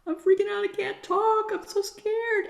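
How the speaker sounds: high, loud, frightened cry; panicked inner child. Acted. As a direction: blurt it out like a terrified kid. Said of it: "giving yourself the time to go".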